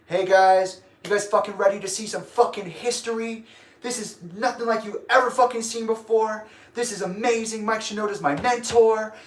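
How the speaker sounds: imitating a gushing opener, pitched high; mock hype-up for the crowd; hype guy. Imitating the opener praising his mentor onstage, high hype voice. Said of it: "he's the hype guy".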